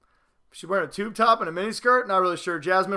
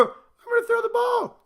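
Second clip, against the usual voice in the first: much higher, a mocking dainty put-on voice; prissy quarterback. Vlogger mocks NFL quarterbacks with a doll, high dainty mock voice.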